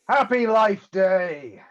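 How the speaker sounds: loud, drawn-out cheer; hearty toast. Acted. Wookiee toasting the arriving drinkers, hearty toast. Character raises a glass in toast.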